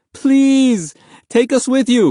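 very high, loud begging; desperate plea. A narrator's reading. Boy fighting to hold on, frantic pleading. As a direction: plead at full cry with effort. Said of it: "He sobbed with the effort".

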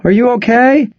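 high anxious wife voice; worried wife. Acted. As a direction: call up to him in worry.

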